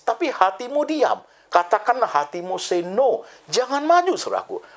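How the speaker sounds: put-on high, urgent preacher warning to the young; impassioned preaching. Preacher warning young people not to marry yet, impassioned high pleading. Warns youth, rising preaching.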